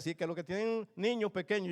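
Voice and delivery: usual speaking voice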